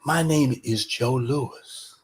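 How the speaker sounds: soft, slow solemn declaration; Ali. Retold or imitated. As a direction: let it land quietly, word by word. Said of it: "I could overhear him say this".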